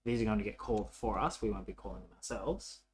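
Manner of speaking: usual speaking voice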